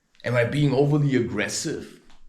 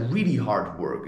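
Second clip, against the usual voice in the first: ordinary delivery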